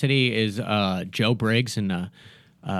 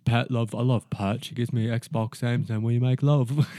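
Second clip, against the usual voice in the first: impression of simple Geordie guy; 2 semitones higher, 6 dB louder than usual. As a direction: sound sweetly dim and earnest. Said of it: "Do your best impression of that one".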